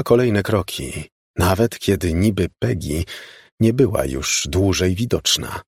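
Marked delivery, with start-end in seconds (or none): none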